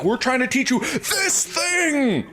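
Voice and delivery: very high, drawn-out "this thing"; hammed-up teacher, slow and high; mock teacher emphasis. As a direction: stretch "this thing" out high like an over-obvious hint. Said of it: "Yeah, it's like".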